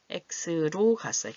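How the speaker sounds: "X로" said slowly as the English letter X plus 로; "X로" with no extra vowel before 로, said slowly; X pronounced as English X. Shown as a demonstration. Teacher to students, modelling "X로" slowly. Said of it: "X 이렇게 발음되니까".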